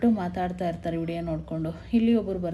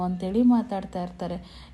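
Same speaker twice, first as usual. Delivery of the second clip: plain reading voice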